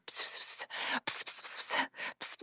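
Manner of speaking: hissed psst psst psst, whispering gossip; whispered psst. Retold or imitated. Gossip whispered across the cafeteria, hushed psst. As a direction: spread the rumour in a hushed psst. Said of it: "literally like that".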